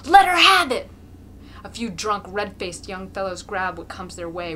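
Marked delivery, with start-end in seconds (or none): 0.1-0.9 high, loud roar; savage yell. A narrator's reading. Mokolka yelling at the young men to beat the mare. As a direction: roar the order.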